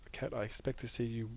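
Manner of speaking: ordinary talk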